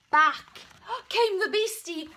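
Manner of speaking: plain reading voice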